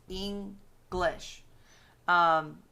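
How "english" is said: "English" split into two beats, with a gap; syllable by syllable. Shown as a demonstration. Teacher showing two syllables in a word, split syllable by syllable. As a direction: break "English" apart as Eng, then lish. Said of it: "that's two syllables".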